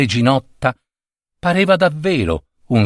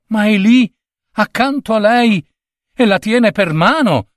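high, eager insistence; high-pitched prince, amazed they can't see; insistent little prince; 9 semitones higher than usual. A narrator's reading. Little prince insists she is there.

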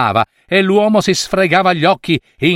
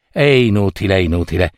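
sighed lower, fading into a sigh; 7 semitones lower, faster than usual. A narrator's reading. Baker giving up without her cat, sighing. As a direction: let the words sink with a weary sigh. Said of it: "disse con un sospiro".

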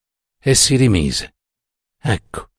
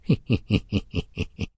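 put-on soft drunken snigger; sneering snicker; 8 dB quieter than usual. A narrator's reading. Drunk professor after his French ditty, sneering snicker. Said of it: "seguito da un ghigno".

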